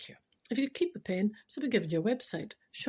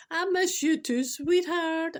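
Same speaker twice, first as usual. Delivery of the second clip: mock sweetheart, higher; 7 semitones higher, 4 dB louder, slower than usual. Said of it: "Every day".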